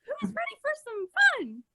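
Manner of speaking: hammed-up kids' TV host voice; cartoon host.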